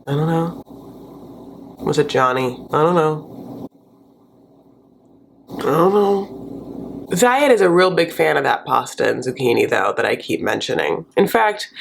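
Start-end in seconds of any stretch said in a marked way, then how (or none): 0.1-7.1 put-on a kid caught with cigarettes mumbling excuses; caught teen mumbling. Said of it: "he's like".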